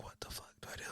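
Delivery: barely-there whispered panic, retold; stunned whisper. Father reacting to news his son won't wake, stunned whisper. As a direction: whisper it as if struck helpless.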